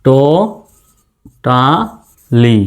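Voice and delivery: টো, টা, লি with a long pause after each; slow, separated syllables "to, ta, li". Shown as a demonstration. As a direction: break it into টো... টা... লি. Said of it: "উচ্চারণটা কেমন হবে?".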